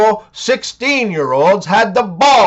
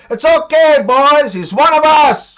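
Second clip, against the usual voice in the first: grinning shout; 6 semitones higher than usual. Acted. Bartender to the whole bar, grinning call. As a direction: call out to the whole room, pleased. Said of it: "grins and shouts out to the whole bar".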